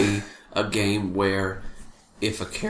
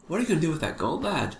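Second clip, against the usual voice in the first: high, quick, soft Irish lilt; Irish ratfolk character, pitched up and rapid; light Irish lilt; 7 semitones higher, 4 dB quieter, faster than usual. Acted. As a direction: toss it off in a light, sing-song Irish voice. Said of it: "I can almost hear him talking to my character".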